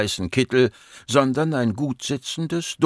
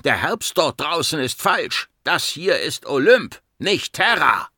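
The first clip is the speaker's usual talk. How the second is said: high-pitched, emphatic angry outburst; roaring; 6 semitones higher than usual. A narrator's reading. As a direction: hammer each statement out in anger. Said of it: "brüllte er". (wütend, mit hoher Stimme)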